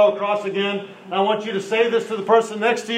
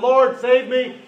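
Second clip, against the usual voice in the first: mock cry for help; 7 semitones higher, slower than usual. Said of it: "He cried out".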